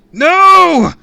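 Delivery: long, very high terrified scream. A narrator's reading. Kid hurled into the sky, screaming. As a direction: scream it at full volume. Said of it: "I screamed again".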